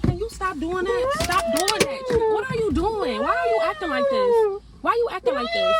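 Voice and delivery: high-pitched, real exasperation; exasperated plea. Real, not acted. Woman begging partner to stop animal noises, exasperated.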